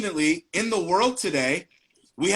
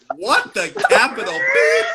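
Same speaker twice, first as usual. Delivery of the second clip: loud, slow incredulous exclamation; hammed-up shocked outburst; mock outrage; 3 semitones higher, 6 dB louder, slower than usual. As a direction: punch out each word in disbelief. Acts out shocked reaction to book.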